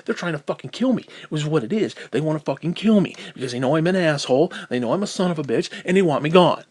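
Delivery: real anger building to a loud finish; angry rant. Real, not acted. Ranting that people want the speaker gone, angry and worked up. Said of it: "I'm getting angry".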